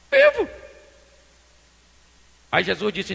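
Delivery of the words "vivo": single word leaping very high; acted high-pitched insistence; high emphatic plea. Acts Marta wanting brother alive.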